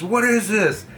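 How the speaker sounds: high-pitched, hammed-up outraged questioning; mock angry demand. Playground monitor confronting kids over a bottle, mock outrage. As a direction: imitate an alarmed adult demanding answers.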